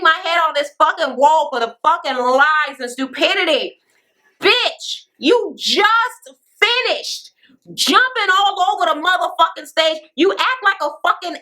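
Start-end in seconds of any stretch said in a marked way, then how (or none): 4.4-7.3 higher and very slow, leaning hard on just; exasperated emphasis. Real, not acted. The recapper calling out Juicy, slow and exasperated. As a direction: drag it out in disbelief. Recapper ranting at a cast member.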